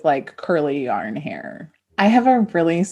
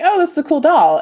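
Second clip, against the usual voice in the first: delighted shopper; 10 semitones higher, 6 dB louder, faster than usual. Retold or imitated. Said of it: "being like".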